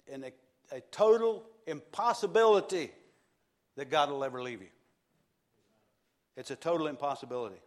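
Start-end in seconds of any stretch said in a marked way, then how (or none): 0.8-2.9 loud and higher, "total" hammered; full-force preacher punch on "total"; preaching loud. Real, not acted. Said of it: "special emphasis". 6.4-7.6 fast, at usual level, "total" hit; brisk preacher restatement; quick repeat. Real, not acted. Repeat of line 85.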